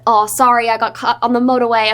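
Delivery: hammed-up New Zealand accent; bad New Zealand accent. Actress imitating her co-star's apology, bad New Zealand accent. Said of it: "That was the worst accent ever".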